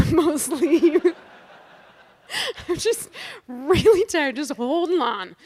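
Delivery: laughing helplessly at how tired she is. Real, not acted. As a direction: giggle out the admission of being tired. Laughing at her own exhaustion.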